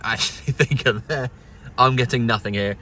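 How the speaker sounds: ordinary talk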